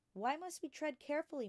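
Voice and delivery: put-on a bright young girl asking her teacher; young Matilda. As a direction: ask like a curious small girl. Matilda's childlike questioning voice.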